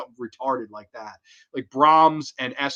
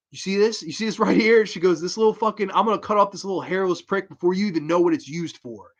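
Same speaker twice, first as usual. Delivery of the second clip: a touch higher, taunting threat; lightly voiced creepy girl taunting; mock menacing child; 5 semitones higher than usual. Esther taunting her brother with the box cutter, mock menace.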